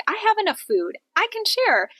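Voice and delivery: high, bright-eyed voice of a woman sharing her food; voicing Charing. Charing, with food to spare, gladly offering to share, voiced high. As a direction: lift way up as Charing, glad to give. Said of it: "She's like".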